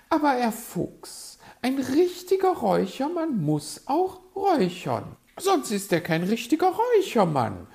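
high, loud bird lady protest; piping magpie, very emphatic; magpie, insistent. A narrator's reading. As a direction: object in a high put-on voice.

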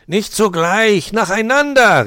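high, loud fox shout; calling out. A narrator's reading. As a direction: call out a loud correction. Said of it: "rief Herr Fuchs".

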